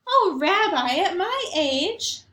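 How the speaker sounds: a cheerful, half-deaf grandmother greeting her guest; Baba Brena. Acted. Grandmother greeting the rabbi at her door, chirpy old lady voice.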